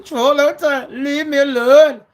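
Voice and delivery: loud, high-pitched wail in imitation; mock crying. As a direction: wail loudly as a crybaby, mocking him. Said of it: "And he's crying, he's going".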